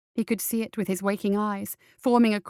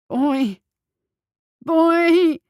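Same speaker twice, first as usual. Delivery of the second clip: rising from high to much higher and louder, a strangled call; gurgled cry; 8 semitones higher, 7 dB louder than usual. A narrator's reading. Follop, choking, calls desperately for the boy, strangled cries. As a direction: choke out the name in desperation. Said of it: "he gurgled".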